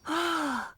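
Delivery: relieved gasp. Acted. Relieved not turning zombie.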